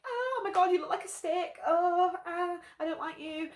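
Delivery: lightly hammed-up snooty girl; mocking the girl. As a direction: sneer like a catty teen. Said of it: "Then you see this girl like".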